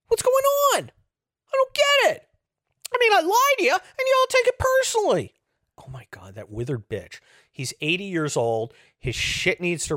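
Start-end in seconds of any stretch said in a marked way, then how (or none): 0.1-5.3 loud, squeaky, wounded bewilderment; hammed-up whine far above normal pitch; mock huffy surprise. Liar baffled that people distrust him, mock huffy surprise. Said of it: "act all huffy and surprised".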